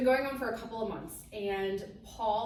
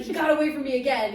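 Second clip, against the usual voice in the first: imitated outburst, loud and fast; mock-furious complaint, rushed out loudly; angry, exasperated; 5 semitones higher, 10 dB louder, much faster than usual. Husband reporting the mouse's latest escape, imitated angry frustration. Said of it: "He's so angry".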